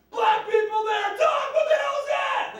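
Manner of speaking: high, stretched shout of alarm; mock-horrified shout. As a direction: build to a high, horrified yell. Builds to shocked punchline.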